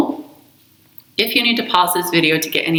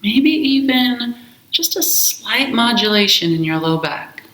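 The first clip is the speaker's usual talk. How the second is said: slow, calm meditation voice with long pauses; guided meditation; slower than usual. Acted. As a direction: guide them gently into the breath, unhurried. Leads a guided breath meditation.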